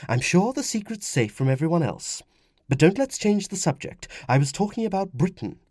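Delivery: high woman's voice, steering the talk; placate, then press on as a wife; soothing, persistent wife. Acted. Wife calms husband, gently insistent.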